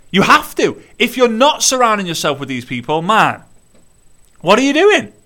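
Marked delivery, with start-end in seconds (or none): 4.4-5.1 incredulous. Real, not acted. Exasperated challenge to viewers.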